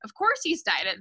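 ordinary delivery, nothing marked

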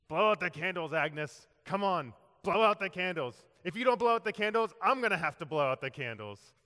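gruff urging. Retold or imitated. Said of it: "Harry gruffly mumbled".

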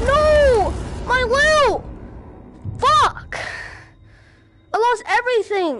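high, loud wail of loss; anguished shout. Real, not acted. Yelling over his lost loot, high and loud. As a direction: cry out as everything is gone.

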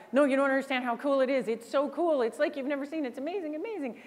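fast, pleading sales pitch; a rep gushing and hurrying to win them over; imitating a rep pleading his product is amazing; rushed eager pitch. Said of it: "he's trying to get through slides".

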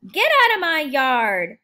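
loud angry yell. Acted. Hummingbird defends his yard from Quincy, loud angry yell. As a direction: shout him off your turf. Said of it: "faces Quincy and yells".

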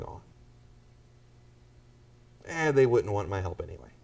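indifferent excuse. Acted. Indifferent believer voiced.

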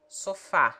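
"sofá" in Portuguese, stress on the final á; Portuguese example word. Shown as a demonstration. Tutor reads out a Portuguese example word. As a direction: say "sofá" the Portuguese way, open a, last syllable stressed.

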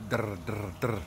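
drr with the tongue fluttering behind the teeth; rolled drr exercise; trill drill. Shown as a demonstration. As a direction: buzz drr with voice and air. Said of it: "that kind of wobble".

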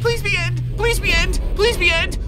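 high-pitched repeated begging; desperate pleading. Real, not acted. Begging for an end game card.

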